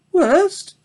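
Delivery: very high, wide-eyed little pig; piglet voice. A narrator's reading. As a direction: innocent piglet asking in surprise. Babe asks ewe.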